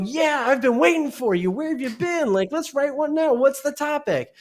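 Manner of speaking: high, bubbly voice of an eager AI assistant; eager chatbot voice. Retold or imitated. AI doppelganger greeting its returning user, high eager voice. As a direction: play the overexcited helper who's been waiting all day. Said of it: "your digital doppelganger is gonna go".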